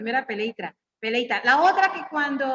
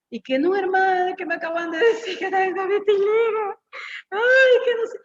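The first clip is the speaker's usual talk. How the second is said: higher, tearful complaint; retold crying; 7 semitones higher, 4 dB louder than usual. Preacher retells telling the brother she had vitiligo, tearful. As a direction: plead through tears, half-acted. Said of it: "yo llorando".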